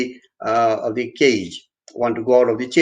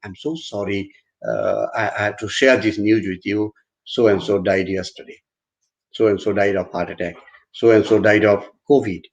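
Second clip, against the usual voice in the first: low, subdued condolence voice; very grim; 4 semitones lower than usual. Shown as a demonstration. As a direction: break bad news gravely and gently, pitched low. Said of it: "something very grim and say".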